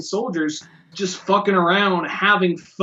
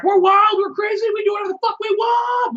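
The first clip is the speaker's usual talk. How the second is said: wild mock boast. Imitating Australia bragging to the world, high mock shout. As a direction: yell it like a rowdy nation bragging.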